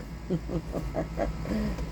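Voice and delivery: quiet scheming laugh as the Grinch. Retold or imitated. Grinch laughing as the brother climbs, soft sly chuckle. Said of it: "the Grinch starts laughing. He's like".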